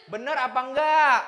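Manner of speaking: usual speaking voice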